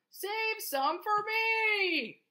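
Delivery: high, long-drawn singsong plea; farmer's cry. A narrator's reading. Farmer begging animals to leave him apples, drawn-out cry. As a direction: stretch every word high, as a storybook farmer calling out. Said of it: "cried the farmer".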